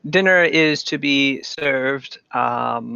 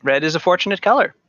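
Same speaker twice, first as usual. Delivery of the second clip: quick, higher-pitched helpful attendant; 6 semitones higher, 4 dB louder, much faster than usual. Acted. Attendant to guests asking about red decor, quick and high. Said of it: "Offers one of the attendants".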